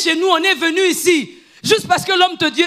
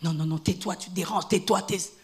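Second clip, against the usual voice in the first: softer, lower telling-off; low, soft hushing; 9 semitones lower, 10 dB quieter than usual. Retold or imitated. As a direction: quietly shush him. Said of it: "Vous lui dites". (la foule le fait taire, voix basse et douce)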